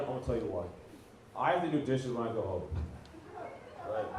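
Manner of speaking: normal talking voice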